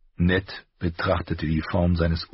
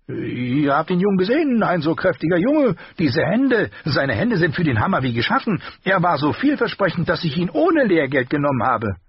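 higher, sharper armourer voice; the master's voice; 11 semitones higher, 4 dB louder than usual. A narrator's reading. Armourer rebuking his apprentice, higher voice. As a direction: scold the boy in a raised voice.

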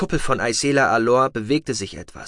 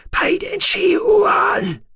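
a very high, slow, drawn-out outcry; shocked oath; 5 dB louder, much slower than usual. A narrator's reading. Shallowayne cursing as the noble dies, a drawn-out outcry. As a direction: cry the oath out in dismay.

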